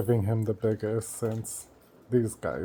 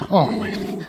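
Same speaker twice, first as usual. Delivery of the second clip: higher, breathy-free whispered coo; doting pet-talk coo, higher; 5 semitones higher than usual. Real, not acted. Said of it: "baby talk to dog repeated".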